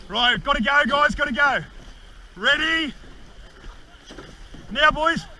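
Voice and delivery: rally the crew, rising urgency; shouting. Real, not acted. [name] calls crew to go.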